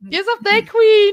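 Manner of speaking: delighted cheer; gleeful. Real, not acted. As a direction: crow it out delighted. High gleeful echo.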